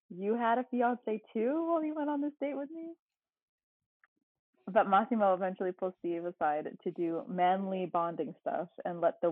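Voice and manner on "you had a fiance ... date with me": higher-pitched mock taunt; rubbing it in. Groom imitated needling the planner, mock rubbing it in. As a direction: imitate someone needling with a pointed question. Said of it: "Steve is now rubbing it in".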